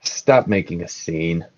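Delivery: low, soft, weary-sounding reproof; sigh, then speak low and quietly, slowing down. Acted. Sirius forgives a bowing minstrel, sighing. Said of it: "just kinda sighs and he says".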